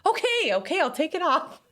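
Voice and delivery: high-pitched, giving-in voice; mock exasperated surrender. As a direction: give in with a high, acted protest. Acts herself giving in to kid.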